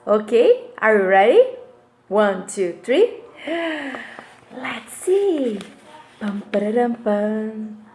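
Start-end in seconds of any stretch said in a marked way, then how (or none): 3.4-4.2 a big pretend gasp of wonder; hammed-up classroom gasp; mock surprised gasp. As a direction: gasp as if amazed by the card. Reveal of surprise card.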